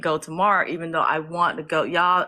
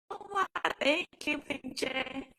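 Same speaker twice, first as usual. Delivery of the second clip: high, hushed, touched thank-you; softly, in a high overwhelmed voice; moved thanks; 7 semitones higher, 9 dB quieter than usual. Real, not acted. Streamer thanking a viewer, high and softly overwhelmed.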